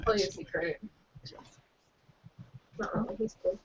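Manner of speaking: hushed, low secret-telling; barely audible aside; too quiet to hear. Real, not acted. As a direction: say it very softly, like a secret. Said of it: "Say that louder".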